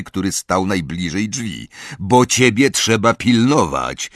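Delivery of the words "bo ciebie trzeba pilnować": raised, louder gruff retort; firm, snarled answer; growled retort. A narrator's reading. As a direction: growl it back with mild force. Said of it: "warknął Moody".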